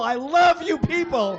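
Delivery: high, loud cry of love, LOVE punched; gushing shout. Real, not acted. Performer to the audience, shouted gushing. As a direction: yell your adoration, leaning on LOVE. Same line, escalated to the crowd.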